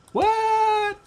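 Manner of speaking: shocked disbelief. Retold or imitated. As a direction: play up the surprise. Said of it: "And I'm like".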